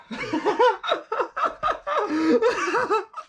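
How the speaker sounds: long rising giggle fit; helpless high laugh. Real, not acted. Alone with the camera, a helpless high laugh. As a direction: laugh you can't stop, climbing high. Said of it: "I start laughing all the time".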